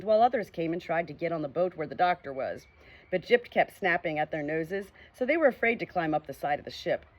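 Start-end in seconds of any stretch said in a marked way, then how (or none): none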